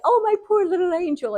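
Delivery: high, gushing fake sympathy; hammed-up fussing; mock cooing parent. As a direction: coo it sarcastically, like an over-doting parent. Mocking a doting parent.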